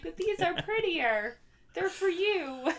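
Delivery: high, soft, slow innocent voice; sweet henchman. Pretend. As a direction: offer it sweetly, like a hopeless henchman. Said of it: "He'd be like".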